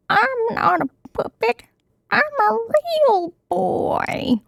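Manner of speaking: high squeaky puppet voice protesting; Pinocchio. Acted. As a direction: pitch way up like a wooden boy.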